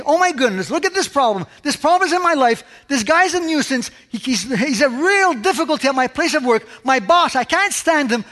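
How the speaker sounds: high, fretful griping about a nuisance; mock the worrier who sees only his problem; imitating a congregant moaning about his boss; fretful complaint. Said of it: "And we see things".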